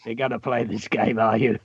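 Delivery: low, quiet, dry delivery. Acted. Teacher reads Missus Pye challenging a player, in a dry voice. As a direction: say it drily, dropped low and soft. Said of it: "says in a dry voice".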